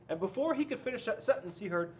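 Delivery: usual speaking voice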